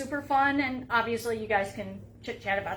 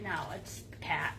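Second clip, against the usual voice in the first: deadpan whisper; 6 semitones lower, 8 dB quieter than usual. Retold or imitated. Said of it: "And he's like".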